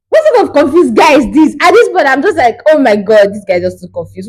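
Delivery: high, loud incredulous outburst; exasperated disbelief. Retold or imitated. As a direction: throw up your hands at him.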